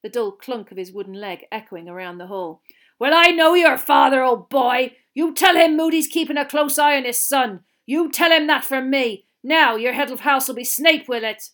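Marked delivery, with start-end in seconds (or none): 3.0-11.5 loud, higher, forceful character voice; menacing old teacher. Acted. Teacher warning Malfoy about his father, loudly menacing. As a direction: warn him off with booming authority.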